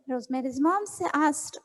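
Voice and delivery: ordinary delivery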